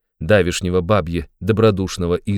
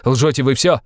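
higher-pitched outburst of accusation; raised, agitated voice; indignant cry; 6 semitones higher, 4 dB louder than usual. A narrator's reading. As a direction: cry it out in protest. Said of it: "вскричал он".

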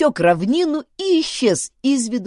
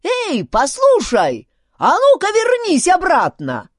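high, loud angry squawk; shrieky little chief, enraged; furious squeal; 12 semitones higher, 7 dB louder than usual. A narrator's reading. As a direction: squeal orders after someone walking off. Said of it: "послышался яростный писк вождя".

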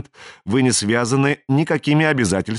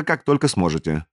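ordinary delivery, nothing marked

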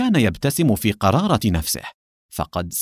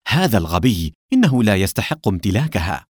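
usual speaking voice

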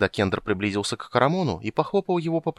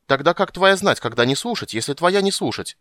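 high, loud indignant squeak; gully dwarf angrily questioning; cross; 9 semitones higher, 5 dB louder than usual. Acted. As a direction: scold him crossly. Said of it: "сердито осведомилась маленькая".

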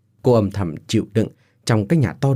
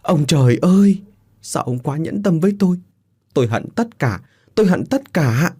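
high, anguished outcry; wailing cry; 7 semitones higher than usual. Acted. Bereaved mother blaming heaven for cruelty, wailing. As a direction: wail your grief at heaven. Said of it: "gào khóc".

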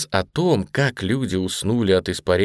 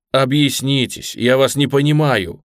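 higher, emphatic plea; imploring, raised voice; pleading; 4 semitones higher, 4 dB louder than usual. A narrator's reading. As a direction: beg for an explanation. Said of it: "взмолился Ружера".

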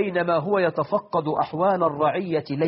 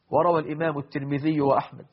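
normal talking voice

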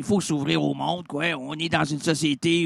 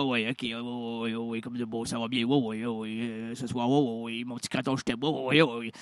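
mumbled imitation; 7 semitones lower, 8 dB quieter than usual. Mimicking Poirier's mumbled ouais.

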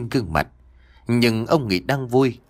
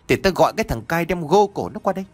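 fast, high-pitched angry threat; 6 semitones higher, faster than usual. A narrator's reading. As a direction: threaten her rapidly, pitch jumping up. Master threatens to summon his henchman.

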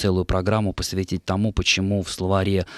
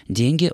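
"деньги" with a higher, stressed first syllable; 7 semitones higher, 3 dB louder than usual. Shown as a demonstration. Presenter states the dictionary rule, first-syllable stress in ДЕНЬги. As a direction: hit the start: ДЕНЬги. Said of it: "ударение должно падать всё-таки на первый слог".

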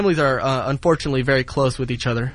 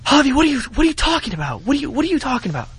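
high falsetto, indignant and startled; mock shocked girlfriend; 12 semitones higher than usual. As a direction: squeak it out like the caught girlfriend. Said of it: "you could tell she's a little heated, a little shocked".

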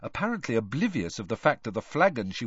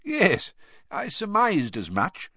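higher-pitched, slow, amused; twinkling old headmaster; 9 semitones higher than usual. A narrator's reading. Dumbledore answering the students, slow and twinkling. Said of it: "his eyes twinkling".

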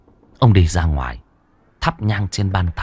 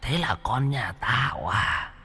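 slow, soft, eerie ghost voice swelling on the last words; a spirit promising revenge, drawn out; vengeful ghost; 6 semitones higher, 5 dB quieter, slower than usual. A narrator's reading. Ghost threatening Vĩnh over her child, slow vengeful voice.

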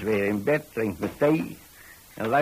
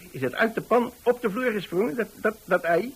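much higher, stammering disbelief; can't believe the egg jumped; incredulous; 9 semitones higher than usual. Acted. Landlord to lodger, high incredulous question.

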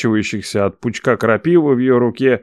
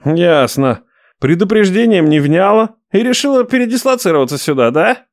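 menacing; 7 semitones higher than usual. Acted. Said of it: "угрожающе уточнил Мот".

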